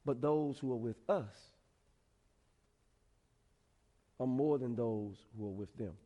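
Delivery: low, soft, measured answer with pauses; quietly steady the frightened servant; calm reassurance. Acted. Calm prophet answers the panicked servant.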